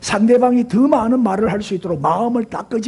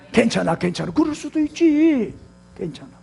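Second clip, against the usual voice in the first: encouraging reassurance; 3 semitones higher than usual. Shown as a demonstration. Preacher showing how to give courage, encouraging reassurance. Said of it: "용기를 주고 격려를 해줘야지".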